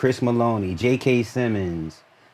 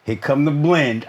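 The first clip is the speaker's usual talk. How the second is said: usual speaking voice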